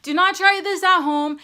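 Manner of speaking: loud stern warning. Acted. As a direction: warn them loudly. Safety warning to viewers.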